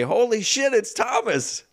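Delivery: high, excited exclamation; surprised shout. Retold or imitated. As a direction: blurt it out in shock. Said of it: "'cause I say".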